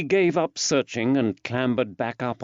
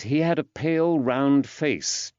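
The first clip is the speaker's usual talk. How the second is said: usual speaking voice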